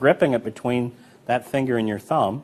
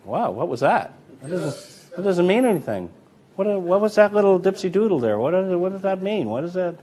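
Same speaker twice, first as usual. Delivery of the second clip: higher, mock-alarmed onlooker spotting a move; suspicious spectator; 7 semitones higher than usual. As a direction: play the spectator who caught the dipsy doodle. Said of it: "their hand would just come up and go".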